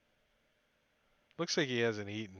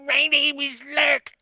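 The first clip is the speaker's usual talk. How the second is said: much higher, louder, slow goblin screech; 12 dB louder, slower than usual. Acted. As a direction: squawk the name out like a goblin. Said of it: "he says in Goblin".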